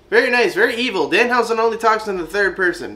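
hammed-up wrestler catchphrase, high and fast; Danhausen catchphrase.

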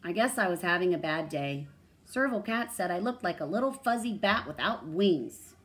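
loud, lively storybook delivery; expressive read-aloud, projected; animated story reading. A narrator's reading. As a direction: read a character's line to children with big swings.

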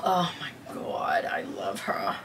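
breathless whisper of love; hushed adoring whisper. Real, not acted. Smelling the leather.

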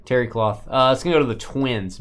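usual speaking voice

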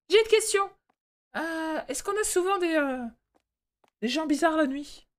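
very high put-on falsetto; timid-employee role voice. Employee asking boss about odd night customers, high falsetto. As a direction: squeak out the question in a falsetto, hesitating.

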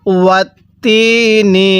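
slow ওয়াতিনি with a long held তি; stretched vowel. Shown as a demonstration. Teacher has learners stretch along, elongated ওয়াতিনি. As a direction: draw out the i of তি in ওয়াতিনি. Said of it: "আমার সাথে টান দিবেন".

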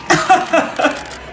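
short unvoiced giggle; a quick airy laugh at her own joke; breathy giggle. Real, not acted. Vlogger laughing at her red carpet joke, airy giggle.

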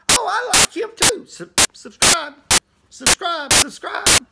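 high, gushing mock viewer voice; eager fans. Minister mimicking viewers rushing to subscribe, high and eager. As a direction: pipe up like a hammed-up excited fan. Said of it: "people like that. They say".

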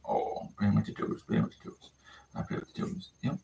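hushed pet talk. Real, not acted. Murmuring to sleepy puppy.

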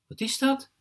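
put-on high-pitched, curious child's question; young boy asking. A narrator's reading. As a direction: ask it high, as little Jesper. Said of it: "vraagt Jesper".

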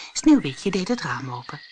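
plain reading voice

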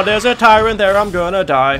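loud, high panicked cry; yelling in real fright. Real, not acted. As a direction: shriek it in terror. Spots the tyrant, panics.

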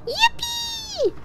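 high, shrieked yippee of joy; excited cheer. Real, not acted. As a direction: squeal with delight. Cheering at a find in game.

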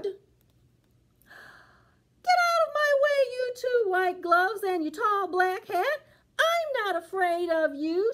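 put-on high, crying-edged old lady voice; an old woman shooing things off, pitched high; spunky granny telling the pants off; defiant little old lady. Old lady defies gloves and hat.

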